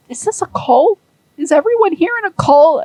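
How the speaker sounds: a baffled newcomer gasping at the ritual; alarmed question. Retold or imitated. Zero alarmed at christening.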